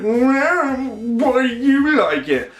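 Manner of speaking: hammed-up jealous whine; whining. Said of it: "It's just him whining".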